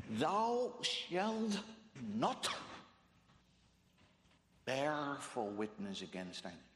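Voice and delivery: "not" set apart by pauses; stress on NOT. Shown as a demonstration. Preacher quoting the commandment, stress on "not". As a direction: hit "not", then pause before going on. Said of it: "Did you hear my emphasis?".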